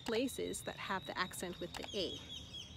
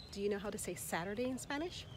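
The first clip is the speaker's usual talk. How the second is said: usual speaking voice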